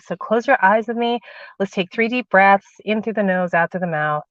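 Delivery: slow, soft meditation voice; guided meditation. Acted. Guiding viewers through a grounding meditation, slow and soft.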